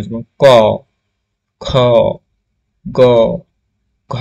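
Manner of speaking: ক, খ, গ, ঘ spaced out, each said from the back of the tongue; velar sounds modelled. Shown as a demonstration. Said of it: "এদেরকে উচ্চারণ করো".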